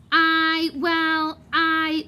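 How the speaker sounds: flustered, caught out; sputtering. Acted. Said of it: "sputtered Lockhart".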